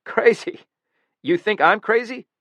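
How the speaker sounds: sharp, high, loud bitter retort; bitter outburst. Acted. Killer to the guard, a bitter high loud retort. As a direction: fling the word back, stung and bitter. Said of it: "A bitter laugh".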